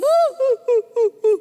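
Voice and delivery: loud, high mock boo-hoo; hammed-up "hu hu" sobs; imitated sobbing. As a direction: imitate a wailing cry in drawn-out hoots. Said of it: "Makin nangis".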